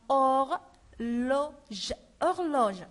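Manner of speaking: "horloge" drawn out very slowly, silent h; slow model word. Shown as a demonstration. Teacher to pupils, modelling slowly. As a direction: stretch "horloge" out for the class. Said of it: "On dit or".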